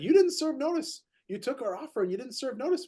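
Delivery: mock indignant protest, pitched high; a retold outburst from the other agent, hammed up high; upset complaint. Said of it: "he was upset".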